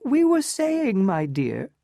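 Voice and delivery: very high, slow, sugary; smiling aunt. Acted. Medora greets Ellen with a smile, high and sugary. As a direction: purr it with a smile. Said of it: "smiled Missus Manson".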